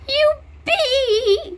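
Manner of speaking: high, loud cheer; excited cheer. Real, not acted. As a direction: cheer down the slide. Going down pool slide.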